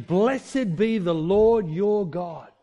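each word slow and weighty; slow solemn quote. Retold or imitated. Preacher quoting the queen slowly and solemnly. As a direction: lay out every word slowly.

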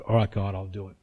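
very low, soft giving-in; understated surrender, fading almost away; retold tearful surrender. Crying man yielding to God in his car, subdued surrender. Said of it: "he's crying and he said".

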